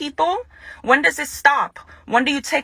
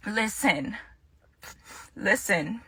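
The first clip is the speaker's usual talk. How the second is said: much softer, lower listen; hushed reset before going on; quiet, collecting herself; 4 semitones lower, 10 dB quieter, slower than usual. Real, not acted. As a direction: pull it right down to steady yourself.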